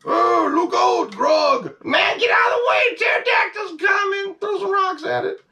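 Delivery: high, loud frantic shouting for the toy cavemen; play-acting the toy scene in a shrill yelling voice; caveman battle cry. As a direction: yell a warning as a panicked caveman. Playing out dinosaur scene with figures.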